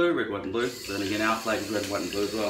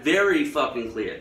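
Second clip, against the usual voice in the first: ordinary talk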